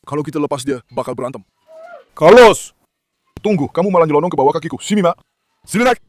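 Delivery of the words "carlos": loud name call; loud call to Carlos. Real, not acted. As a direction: shout for the dog. Calling dog.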